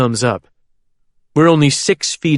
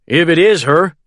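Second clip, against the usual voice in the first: raised pitch with a touch of worry; worried; 5 semitones higher, 4 dB louder than usual. A narrator's reading. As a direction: voice a mild concern. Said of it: "Danny says worriedly".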